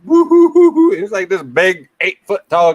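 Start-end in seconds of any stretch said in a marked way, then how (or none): 0.0-0.9 high, loud mock wailing of a giant; imitated wailing. Imitating the giant crying in the movie, loud mock wail. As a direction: wail like a big man crying. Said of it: "Andre going".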